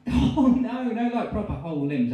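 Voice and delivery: high, loud eager correction; excited gossip. Acted. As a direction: correct the friend with relish.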